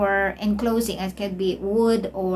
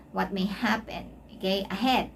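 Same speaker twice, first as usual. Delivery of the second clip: ordinary talk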